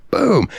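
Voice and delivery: high sharp bam; punch sound. Retold or imitated. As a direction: smack the sound out. Punch sound in fight story.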